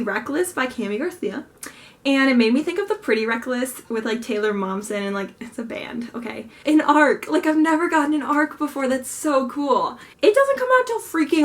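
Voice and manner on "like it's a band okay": whisper. Acted. Aside about band name.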